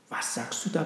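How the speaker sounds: plain reading voice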